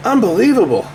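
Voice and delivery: normal talking voice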